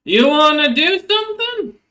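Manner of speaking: high, loud troll boss taunt; Red Maw. Acted. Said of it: "spits on the ground".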